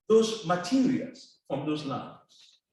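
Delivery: ordinary talk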